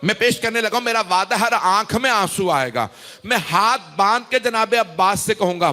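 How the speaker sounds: normal talking voice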